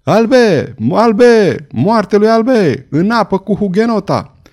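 slow, loud, raging cry; mob yelling. A narrator's reading. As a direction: bellow it like a lynch mob. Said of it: "gesticulând și vociferând".

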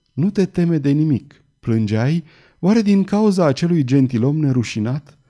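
usual speaking voice

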